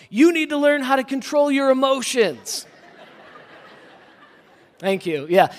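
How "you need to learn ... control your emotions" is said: loud, high-pitched parental yell. Retold or imitated. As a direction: re-enact yelling at your kid. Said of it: "And then I yelled".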